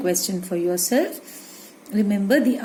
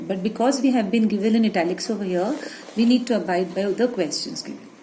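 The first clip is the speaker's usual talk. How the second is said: ordinary delivery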